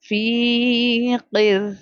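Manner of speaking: slow فی قر with فی drawn out; lengthened fi. Shown as a demonstration. Teacher explaining the long ya, stretched فی. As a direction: stretch the vowel of فی. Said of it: "فی کو میں اس لیے کھینچ کے پڑھی".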